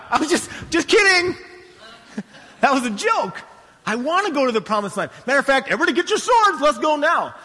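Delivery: high, eager acted outburst; hammed-up panicky enthusiasm, pitched right up; frantic backtracking. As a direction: scramble to take it back, then rally everyone. People hastily backtrack, then rally.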